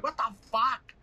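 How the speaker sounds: incredulous shout. Retold or imitated. Own shocked reaction retold.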